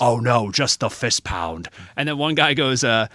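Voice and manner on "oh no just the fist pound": slow, louder Ventura voice; Jesse Ventura impression. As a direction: drag it out like Ventura.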